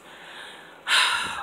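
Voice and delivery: heavy imitated exhale; mad mom's sigh. Mom hearing the result, retold angry sigh. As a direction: huff it out like an exasperated mother. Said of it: "like, she's mad".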